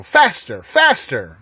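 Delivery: loud frantic urging; shouting. A narrator's reading. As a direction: yell it like a madman driving on.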